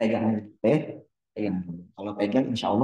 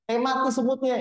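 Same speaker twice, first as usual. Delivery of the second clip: mock surprise; 11 semitones higher than usual. Startled at killing the ant.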